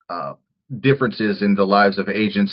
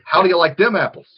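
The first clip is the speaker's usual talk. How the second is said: put-on high, teasing Boston accent parody; mock Boston accent; 10 semitones higher than usual. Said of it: "She's gotta say that ... with that accent".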